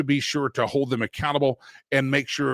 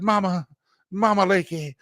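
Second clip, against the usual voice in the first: put-on Southern kid voice; 5 semitones higher than usual. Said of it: "I didn't talk like a Southern kid".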